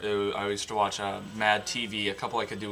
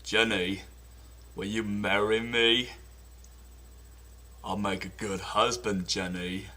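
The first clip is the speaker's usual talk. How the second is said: Forrest Gump; 4 semitones lower, 6 dB quieter than usual. Retold or imitated. Forrest proposing to Jenny, lightly voiced Southern. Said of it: "Now for Forrest Gump".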